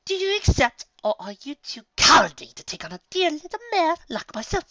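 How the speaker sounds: high, sugary taunt. A narrator's reading. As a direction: goad him sweetly. Rarity taunting the commander.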